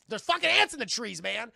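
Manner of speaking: loud, high mock panic; acted freak-out; alarmed outburst. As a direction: yell it like a frantic warning. Acting alarmed character.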